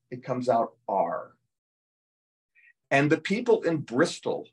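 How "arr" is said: "R" as a drawn-out pirate "arr". Shown as a demonstration. Explaining the Bristol sound, "R" as long "arr". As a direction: say "R" slowly, like "aye" turned to "arr". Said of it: "put an R into the I".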